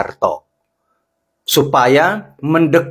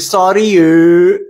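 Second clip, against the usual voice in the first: high, louder diva sorry yuk; sassy sorry; 3 semitones higher, 4 dB louder, much slower than usual. Acted. Host brushes off critics with a sassy sorry. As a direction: toss it off like a diva.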